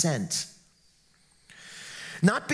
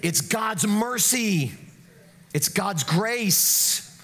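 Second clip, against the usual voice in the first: ordinary talk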